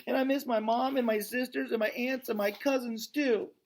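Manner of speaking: put-on high, small child's voice; a young elephant missing her family; little elephant Ruby. Young elephant lists family she misses, high child voice.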